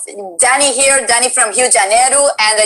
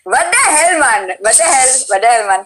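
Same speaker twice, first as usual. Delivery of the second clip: imitated Indian accent; Sandeep; 3 semitones higher than usual. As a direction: exclaim it with an amateur Indian accent. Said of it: "I lock into Sandeep 'cause he would go".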